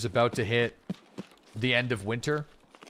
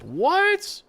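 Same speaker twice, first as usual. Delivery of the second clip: surprised; 4 dB louder than usual. Real, not acted. Amazed at weapon damage.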